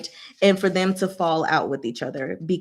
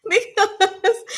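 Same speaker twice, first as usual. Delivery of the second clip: laughing; 11 semitones higher than usual. Real, not acted. Laughing about flirting.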